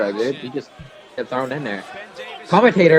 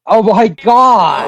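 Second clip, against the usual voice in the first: surprised; 3 semitones higher, 7 dB louder, slower than usual. Real, not acted. Surprise entrant appears.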